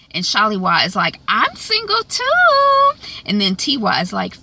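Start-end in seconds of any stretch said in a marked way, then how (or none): 1.3-2.9 a drawn-out eager squeal; eager high-pitched squeal. Retold or imitated. Sister eagerly jumps in, acted quote.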